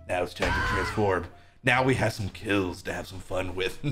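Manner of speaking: very low, hushed gloating voice; sinister villain. Acted. Killer about to transform and hunt, sinister villain. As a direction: gloat softly from deep down.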